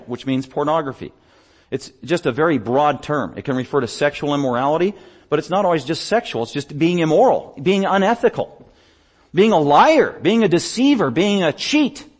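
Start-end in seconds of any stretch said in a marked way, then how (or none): 5.3-12.1 raised, pressing pulpit voice; emphatic preaching list. Real, not acted. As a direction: hit each item higher and harder.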